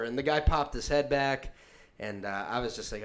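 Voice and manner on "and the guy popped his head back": ordinary delivery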